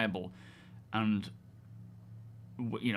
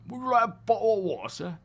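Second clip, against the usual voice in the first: hammed-up English accent, high and quick; higher, exaggerated English-accent offer; 10 semitones higher, 5 dB louder, faster than usual. Shown as a demonstration. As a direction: demo an English accent for a Renaissance fair. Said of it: "speaking in an English accent".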